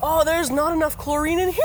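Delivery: ordinary delivery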